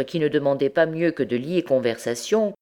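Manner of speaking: plain reading voice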